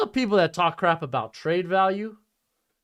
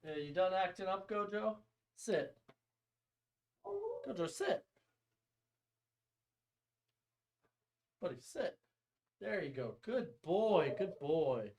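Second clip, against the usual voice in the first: soothing the dog; 2 semitones higher, 12 dB quieter than usual. Real, not acted. Settling his dog Gojo.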